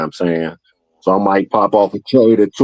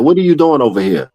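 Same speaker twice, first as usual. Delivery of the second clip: higher mock voice of the stranger, asking; a lightly voiced curious stranger, pitched up; imitating the stranger; 8 semitones higher, faster than usual. Stranger asks why he's here.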